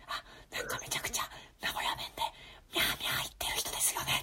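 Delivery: whispered so softly it can't be heard. Real, not acted. Quoting his reply to a housemate, in a whisper. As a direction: hush the quote down to a breath. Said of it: "全然聞こえねえよ".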